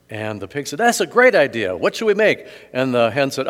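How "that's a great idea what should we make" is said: higher and louder, an eager pig. Acted. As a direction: pig jumping at the idea, pitched up. Pig voiced in joke.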